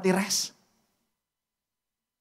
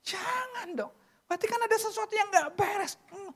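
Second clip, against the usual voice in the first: mock dismay; 10 semitones higher, 4 dB quieter than usual. Preacher recoils at the thought.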